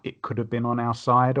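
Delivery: ordinary talk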